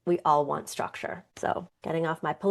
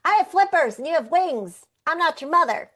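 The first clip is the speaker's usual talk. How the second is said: much higher, louder cartoon penguin; 12 semitones higher, 6 dB louder than usual. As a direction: turn him down as a chirpy penguin. Penguin answers bird in story.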